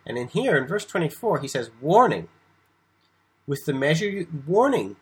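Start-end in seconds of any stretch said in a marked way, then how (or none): 1.8-2.3 "Warning," louder with a fall; "Warning," stressed loud. Shown as a demonstration. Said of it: "it's more like warning". 4.5-5.0 "warning," louder and higher; "warning," high, loud and falling. Shown as a demonstration. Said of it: "it's more like warning".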